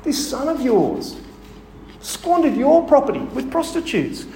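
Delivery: resentful elder son lashing out at his father; vitriolic. Retold or imitated. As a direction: spit out the accusation against the brother. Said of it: "You can hear the vitriol in his voice".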